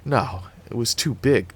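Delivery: usual speaking voice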